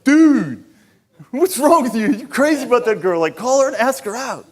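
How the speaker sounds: loud, high urging from an exasperated buddy; amico che sprona. Retold or imitated. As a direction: pump your friend up, loud and high. Buddy's pep talk acted out.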